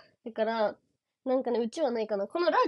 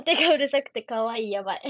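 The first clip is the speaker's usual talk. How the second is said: normal talking voice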